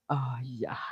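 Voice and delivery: soft breathy aside; whisper. Real, not acted. Streamer whispering to herself on stream. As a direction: whisper it under your breath.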